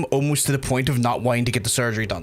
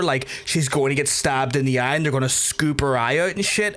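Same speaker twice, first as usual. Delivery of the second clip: normal talking voice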